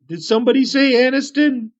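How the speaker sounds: high, startled hermit voice; Aniston, perking up. Acted. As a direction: pipe up high on hearing your name. GM as Aniston, overhearing his name.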